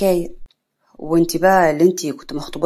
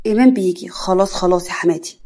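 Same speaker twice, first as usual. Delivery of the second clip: ordinary delivery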